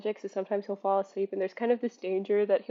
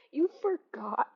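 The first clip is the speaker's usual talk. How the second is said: laughing disbelief; 6 semitones higher than usual. Real, not acted. Laughing at the outrage.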